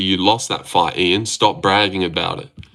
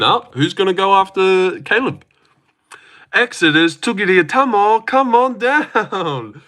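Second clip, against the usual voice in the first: ring announcer; 9 semitones higher than usual. Pretend. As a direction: call them down like a fight-night MC. Announcer calling fighters in.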